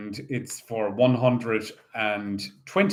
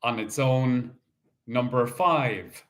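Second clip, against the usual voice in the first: bingo caller. Acted. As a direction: call each ball out steadily. Calls bingo numbers.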